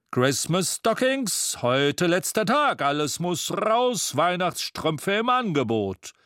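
a Santa ringing his bell, crying out his sale; street vendor calling out. Acted. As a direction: hawk the stockings like a Christmas market seller.

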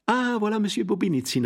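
high, sugary greeting; sweetest tones. A narrator's reading. Lady hails Monsieur Bobinizin in her sweetest, high tones. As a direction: greet him as sweetly as possible. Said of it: "in den süßesten Tönen".

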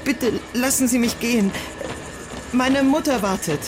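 scared pleading. Acted. Junge verängstigt, will weg.

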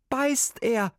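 put-on nervous boy blurting out his trouble; boy's voice.

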